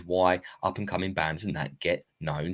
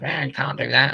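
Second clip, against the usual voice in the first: high, loud mock of a bitter aunt; 7 semitones higher, 5 dB louder than usual. Auntie Mildred shooting down a goal, loud high sneer. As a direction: sneer it like a miserable old aunt. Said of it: "turns and goes".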